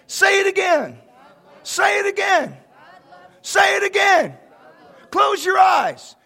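loud, high, fervent calls to repeat; rally the room to shout it back; call and response preaching. Real, not acted. Pastor leading church to declare God's love, shouting.

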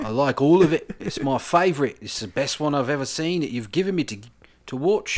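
gushing, hammed-up Geordie; comically overdone Geordie praise; attempted Geordie accent. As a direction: lay on a broad fake Geordie accent. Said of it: "trying to do a Geordie accent".

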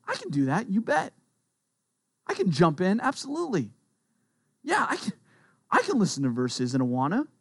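volunteer gladly, pitch leaping up; cheerfully willing. Acted. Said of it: "Are you willing to cheerfully".

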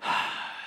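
long, soft sigh before speaking; weary little sigh, acted out; imitated child's sigh. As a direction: let out a heavy, quiet sigh as the child. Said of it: "So she sighed".

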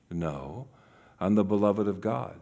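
low, soft, steady answer; quiet self-reminder. Acted. Jesus reminding himself who he is, quiet and low. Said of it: "he would have to remember".

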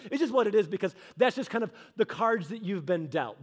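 ordinary talk

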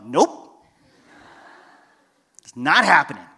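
loud, emphatic mock refusal; stubborn no, played for laughs; flat refusal. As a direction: shut it down loudly. Retold refusal of God's prompting.

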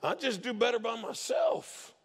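hammed-up grouchy hermit wanting solitude; Shrek. Cynic retreats from people into the swamp, mock grumpy. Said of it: "we kinda join Shrek".

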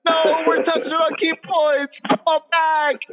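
high, loud hammed-up "come back" plea; mock panicked plea. Friend mimicking the group begging Dave to rejoin, mock frantic. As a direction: playfully act out the group's panic at the dropped call. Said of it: "we like".

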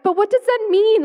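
high, loud, baffled protest; exasperated question. Retold or imitated. To other Christians, pleading in exasperated disbelief. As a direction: throw the question back in disbelief. Exasperated retort to pat advice.

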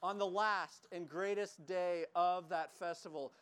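ordinary talk, nothing marked

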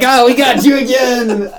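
high, drawn-out gloating; teasing, sing-out mock triumph; playful taunt. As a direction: crow it slowly like a prankster. Film teasing its audience.

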